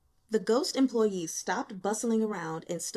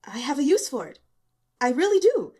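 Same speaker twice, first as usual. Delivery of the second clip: rising higher and much louder; earnest insistence; 5 semitones higher, 9 dB louder, faster than usual. Acted. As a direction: insist he truly means it, building. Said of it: "face serious".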